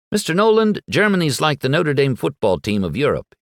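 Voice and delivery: sure of himself, high and eager; unabashed certainty. A narrator's reading. Boy telling the bar pilot the war facts, with certainty. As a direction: state it like an undeniable fact. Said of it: "with unabashed certainty".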